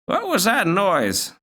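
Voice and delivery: slow, high granny asking; a confused old gran, drawn out; high old grandmother voice. Acted. Grandmother asking Zack about the noise, slow high old-lady voice.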